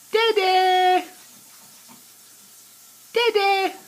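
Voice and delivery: high bright calls of dada. Real, not acted. Parent coaxes the baby to say dada, high and excited.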